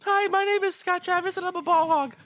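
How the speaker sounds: a hint of a high put-on voice; [name] voice. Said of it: "this is the Scott voice now".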